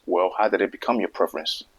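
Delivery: normal talking voice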